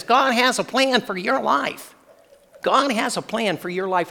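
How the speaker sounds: a hint of Barney Fife pointing and preaching; squeaky little man. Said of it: "kind of a high-pitched, squeaky voice".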